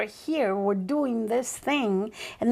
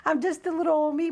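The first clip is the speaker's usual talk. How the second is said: humbled awe; 6 semitones higher than usual. Retold or imitated. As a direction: play it small and wide-eyed. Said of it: "'Cause I'm thinking".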